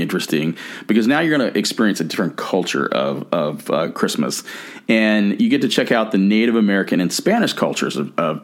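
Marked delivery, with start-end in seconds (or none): none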